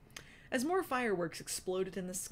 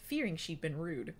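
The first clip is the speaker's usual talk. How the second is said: ordinary delivery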